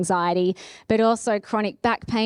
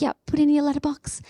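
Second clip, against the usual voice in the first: quick whispered aside to mum; 5 semitones higher, faster than usual. Retold or imitated. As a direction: hush it like a secret instruction. Mum afraid of catching covid.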